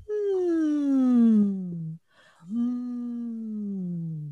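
gentle high hum; warm-up hum. Shown as a demonstration. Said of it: "So even just going".

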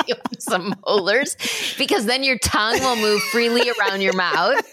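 laughing through the words; giggling. Real, not acted. Giggling tease of blooper.

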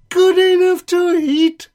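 high, loud alarmed shriek. A narrator's reading. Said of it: "shrieked the gingerbread man".

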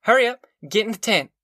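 high-pitched urgent orders; fierce. A narrator's reading. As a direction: hurry the children along fiercely. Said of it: "she cried fiercely".

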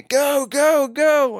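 high-pitched mock scream from the corner; imitated screaming. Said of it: "they're screaming at me". (matkittu korkea kiljuminen)

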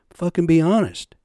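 a retold angry command. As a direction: hammer the last words home. Ranting at former boss.

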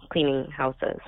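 ordinary talk

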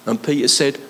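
ordinary talk, nothing marked

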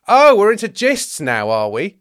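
slow, loud sarcastic question, starting high; mock the excuse, pitch swooping down; scornful mockery. Teacher of the law scoffs at James's gist, sarcastic.

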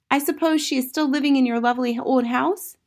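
put-on high, insistent child's voice; probing young Matilda. A narrator's reading. Matilda questioning about the aunt, high inquisitive voice. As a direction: probe like an inquisitive little girl.

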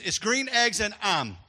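"I'm" said for "Ham", slowly; a son's Jamaican reading. Retold or imitated. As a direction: read "Green Eggs and I'm" as Jeremy says it. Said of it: "That's the way he talks in Jamaica".

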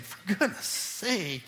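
parent's scold. Shown as a demonstration. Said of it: "That's how parents say it".

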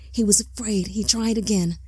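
usual speaking voice

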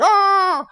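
high, loud startled "Ah"; a kid caught by surprise, squeaking high; startled kid yelp. Retold or imitated. Boy caught running by his dad, high startled yelp. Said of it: "the kid's like".

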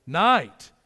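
NIGHT punched out loud and falling; emphatic night. Acted. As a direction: hit night hard as the answer. Preacher answers his own question.

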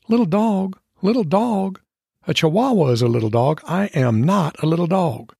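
high, indignant dog voice; offended dog. Acted. Maggie insulted by the cat, high-pitched outrage. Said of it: "Maggie and the cat exchanged telepathic remarks".

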